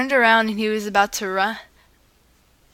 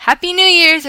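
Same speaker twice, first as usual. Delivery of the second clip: yelled; 9 semitones higher, 8 dB louder than usual. Acted. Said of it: "everyone yelled".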